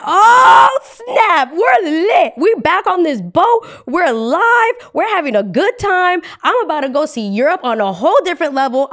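high, loud triumphant whooping; hammed-up elation, belted out; excited celebration. Traveler finally cleared for the cruise, wildly celebrating.

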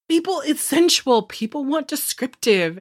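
lightly voiced author defending his prose; defensive Ronnie. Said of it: "He's like".

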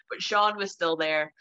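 ordinary talk, nothing marked

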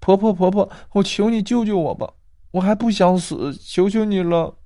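put-on high, begging girl; pleading. Girl begging grandma to save her, high and pleading. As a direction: plead for your life. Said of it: "女孩乞求地说道".

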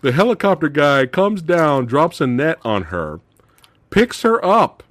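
ordinary talk, nothing marked

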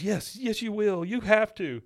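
high, pleading movie son; Ethan Hawke. Acted. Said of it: "Ethan Hawke's character says".

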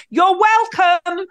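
pointed New York you're welcome; loud you're welcome. Retold or imitated. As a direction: boom it out pointedly. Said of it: "very loudly".

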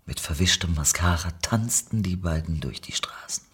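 ordinary delivery, nothing marked

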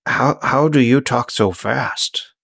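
slow, halting question; Grendon, slow talker. Acted. Grendon tries again to ask, slow and halting. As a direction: struggle slowly through each word. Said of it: "It hurts when I talk, so I talk real slow".

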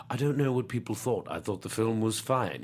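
low, soft, understated actor's reading; Jeremy Irons. Retold or imitated. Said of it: "rather than him saying".

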